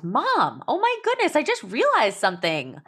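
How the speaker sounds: put-on high-pitched child's announcement, building; excited little girl. Daughter to mom on the drive to school, excited. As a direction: imitate a kid's big discovery, slowing on the last words.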